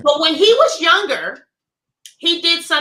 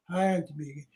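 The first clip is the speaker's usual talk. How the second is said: much lower and softer nephew greeting; low-voiced nephew; 11 semitones lower, 12 dB quieter than usual. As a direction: drop deep and quiet like the big nephew. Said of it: "'cause he talks real low".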